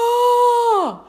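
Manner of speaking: sharp yelp of pain; high startled cry; retold yelp. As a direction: cry out as you hit the floor. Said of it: "and I heard a".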